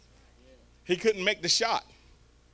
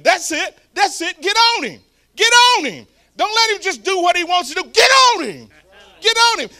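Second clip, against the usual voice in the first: yelling from the sidelines, much higher and louder; screaming; 12 semitones higher, 13 dB louder than usual. Retold or imitated. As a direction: scream it like a fired-up grandpa. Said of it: "grandfather started screaming".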